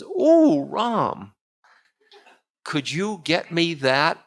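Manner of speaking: high, cooing plea to her husband; high sweet begging voice; Sita coaxing. Acted. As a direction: coo it like a spoiled sweetheart. Sita coos at Ram for deer.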